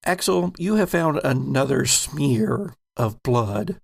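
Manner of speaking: ordinary talk, nothing marked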